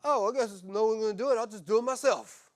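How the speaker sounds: loud mock sigh of someone doing it all alone; passive-aggressive. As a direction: act the put-upon martyr for the room. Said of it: "the passive-aggressive".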